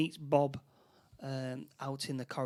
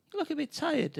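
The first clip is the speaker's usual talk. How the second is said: high woman's voice, lightly put on; lightly voiced woman; 11 semitones higher than usual. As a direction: voice Jane pitched up. Jane voiced in retelling.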